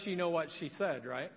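ordinary talk

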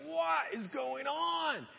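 high, very drawn-out exclamation; mock baffled cry. Philistines watching Samson break free, mock bewildered shout. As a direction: stretch it out in exaggerated bewilderment.

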